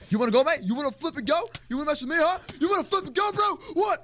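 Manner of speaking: high squeaky taunts climbing to shrieks; mock tough talk, building. Player daring an enemy to fight, escalating mock taunts.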